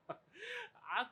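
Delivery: high, breathy burst of laughing; laughing through the words; real laughter. Real, not acted. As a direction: crack up mid-story. Laughing at own story.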